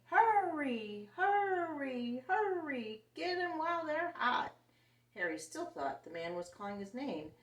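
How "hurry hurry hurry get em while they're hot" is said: high, loud hawker's cry; vendor shouting. A narrator's reading. Hot dog man shouting to sell his hot dogs, high and loud. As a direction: bark it out to draw the crowd. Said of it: "He was shouting".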